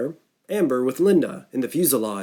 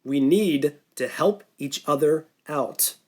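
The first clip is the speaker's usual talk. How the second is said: "We need to help each other out" slow, each word pressed; emphasizing every word; 2 semitones higher, slower than usual. Acted. Norton staring at Mitch, pressing every word. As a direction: spell it out word by word. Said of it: "he emphasized every word of that last sentence".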